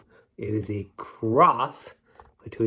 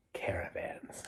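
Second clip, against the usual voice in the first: breathy final whisper; hushed poem ending; 15 dB quieter, slower than usual. Acted. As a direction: whisper the last word away. Whispered last word of poem.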